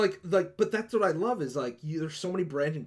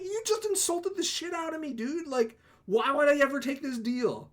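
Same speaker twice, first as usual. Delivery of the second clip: much higher, disbelieving scoff at the offer; mock incredulous; 10 semitones higher than usual. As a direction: sound outraged he'd even ask. Player rejects the drow's offer.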